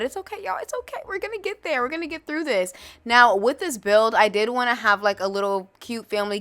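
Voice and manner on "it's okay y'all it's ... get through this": high, soft singsong comfort; self-reassuring. Real, not acted. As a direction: soothe yourself, much higher and hushed. Reassuring herself and viewers.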